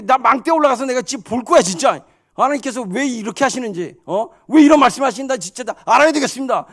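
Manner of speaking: loud, high defiant insistence; acted prophet hotly demanding to know; worked-up, veins bulging. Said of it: "핏대 올리면서 막".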